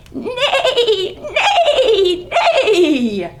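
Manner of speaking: high neigh broken by laughter; laughing neigh. Acted. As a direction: neigh like a giggling pony. Pony prancing in story.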